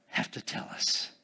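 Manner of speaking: whispered aside to the congregation. Acted. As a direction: drop to a breathy whisper mid-question. Preacher hushes mid-question.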